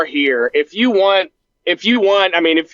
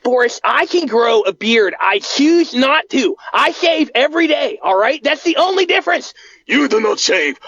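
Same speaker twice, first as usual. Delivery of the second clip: higher, defensive whine; Bart voice; 6 semitones higher than usual. Acted. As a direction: insist you can grow a beard.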